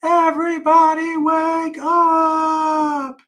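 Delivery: long, high wake-up call held on every syllable; high drawn-out shout. Acted. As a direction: shout it slowly to wake the whole house. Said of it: "and hollered".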